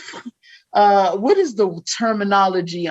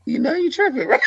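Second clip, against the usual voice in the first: half-whispered mock warning to a husband; discreet wifely aside; 4 semitones higher than usual. Wife privately checking her husband in public, discreet murmur. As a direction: lean in and murmur it like a tactful wife. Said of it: "responsibility to say".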